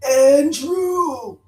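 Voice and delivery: drawn-out, high howl of a name; loud mock dog howl. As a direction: howl it like a pack of dogs. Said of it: "they all stopped ... they were like".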